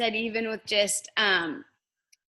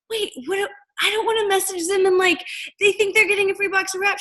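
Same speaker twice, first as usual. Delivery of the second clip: panicked; 9 semitones higher than usual. Retold or imitated. Retold panicked reaction to messaging.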